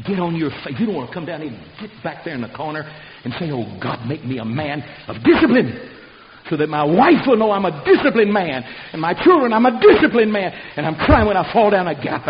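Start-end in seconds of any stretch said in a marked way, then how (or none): 3.5-12.3 builds from pleading to a loud, high cry; rising loud prayer. Acted. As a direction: cry it out louder and higher with each line. Voices a man praying aloud.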